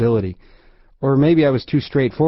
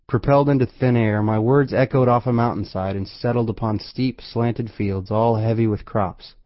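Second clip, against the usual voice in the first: usual speaking voice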